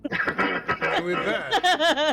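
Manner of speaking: drawn out, slow and high; slow dramatic drawl. Acted. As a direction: stretch it like a finale. Dramatic sign-off with music.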